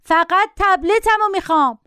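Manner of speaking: put-on high-pitched, insistent boy's cry; shouting child. A narrator's reading. Boy demanding his tablet from the passenger, shouting. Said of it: "داد زد".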